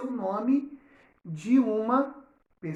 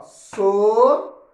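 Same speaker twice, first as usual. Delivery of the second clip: "Socorro." split as so, cor, ro with long pauses; syllable by syllable; 8 dB louder, much slower than usual. Shown as a demonstration. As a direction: count out "Socorro." loudly, one drawn-out syllable at a time. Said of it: "Vamos contar as sílabas". ("Socorro." separado em sílabas: so, cor, ro)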